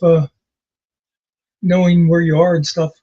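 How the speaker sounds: normal talking voice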